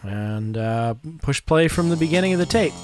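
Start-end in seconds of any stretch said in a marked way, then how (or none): none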